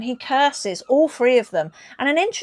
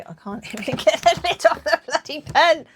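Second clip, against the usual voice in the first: laughing; 6 semitones higher, 3 dB louder than usual. Real, not acted. Struggling with pen lid laughing.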